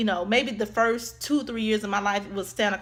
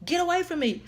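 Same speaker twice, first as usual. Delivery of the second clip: retold alarm; 8 semitones higher than usual. Retelling her frightened reply to stranger.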